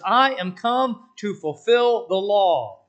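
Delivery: booming, slow proclamation; proclaimed loud. Acted. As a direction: declare it like a pulpit. Preacher proclaims Jesus' words.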